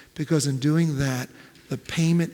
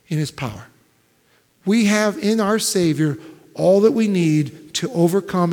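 loud, ringing sermon build; preaching; 3 semitones higher, 5 dB louder than usual. Acted. Preacher to his congregation, loud full-force preaching.

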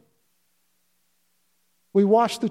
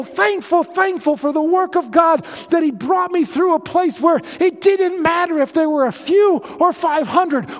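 rising, louder preacher's build, driving each phrase home; impassioned sermon climax with pounding emphasis; preaching at full force; 8 semitones higher, 6 dB louder than usual. Acted. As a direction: preach it out to the congregation.

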